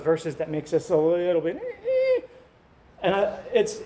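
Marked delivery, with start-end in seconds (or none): 1.6-2.2 uneasy squirm. Acted. Verses make us uneasy.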